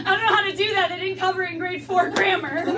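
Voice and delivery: high-pitched comic helplessness; mock panic. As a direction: blurt it out high, in pretend panic. Acted panic at failing French.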